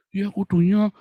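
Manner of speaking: high, drawn mock-polite voice; mock gentle politeness. Critic prescribing how a servant of God should talk, mock gentle. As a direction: imitate someone being oh so gentle. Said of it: "sih nggak gini".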